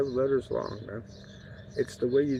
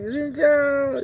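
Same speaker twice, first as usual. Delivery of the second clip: much higher, louder returned greeting; mimicking the reply; 13 semitones higher, 7 dB louder, much slower than usual. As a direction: echo the hello back up high. Old women greeting back.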